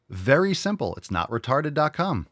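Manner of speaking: ordinary delivery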